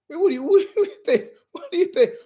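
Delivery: mock him while cracking up; laughing mockery.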